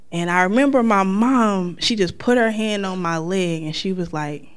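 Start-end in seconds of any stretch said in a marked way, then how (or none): none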